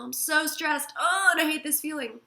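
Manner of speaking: fed-up groan at the feeling; frustrated outburst. Shown as a demonstration. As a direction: let the frustration burst out. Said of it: "and then you say".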